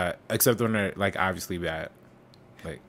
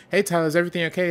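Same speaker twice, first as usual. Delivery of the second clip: higher, singsong mom voice; mom checking in; 6 semitones higher, much faster than usual. Retold or imitated. Co-host retelling mom looking in on Tyler, mom voice. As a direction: lightly voice a checking-in mother. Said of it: "his mom comes in, is like".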